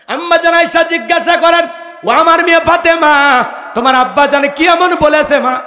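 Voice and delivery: high, loud chanting delivery; full-force preaching. Acted. Preacher voices Aisha questioning Fatema, high, loud preaching. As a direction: ring it out like an impassioned preacher.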